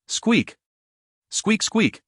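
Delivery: short high-pitched mouse squeaks. A narrator's reading. Waking mouse in the sealed chamber, high squeaking.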